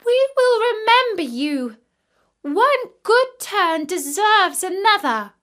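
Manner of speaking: put-on young ravens calling out their gratitude; ravens crying out. A narrator's reading. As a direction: chirp your thanks eagerly. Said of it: "They cried".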